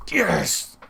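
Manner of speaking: high delighted yes; excited cheer. Real, not acted. Gamer cheering his striker's goal, delighted cry. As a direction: celebrate the goal.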